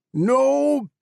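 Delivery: high, loud desperate shout. A narrator's reading. David seeing the trap, desperate loud shout. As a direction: scream it as you run. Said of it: "he shouted".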